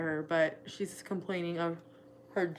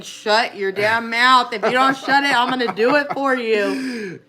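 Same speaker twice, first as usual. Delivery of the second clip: mock universe voice; 4 semitones higher, 12 dB louder than usual. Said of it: "the universe saying".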